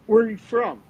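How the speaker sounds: high, sharp demand from an angry cop; confronting. Retold or imitated. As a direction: get in his face with the question. Partner confronting church pisser.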